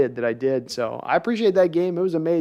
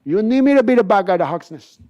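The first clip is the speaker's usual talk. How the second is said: higher and louder gangster line; punchy mock Scarface; Scarface impression; 5 semitones higher, 4 dB louder than usual. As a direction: push it out like Tony Montana. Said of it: "I can do a little bit of Scarface".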